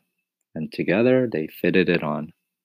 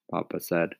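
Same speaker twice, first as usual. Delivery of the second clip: usual speaking voice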